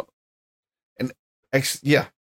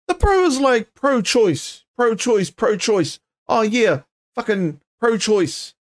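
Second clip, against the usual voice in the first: mocking; 6 semitones higher, slower than usual. Mocking Tamaki's pro-choice stance.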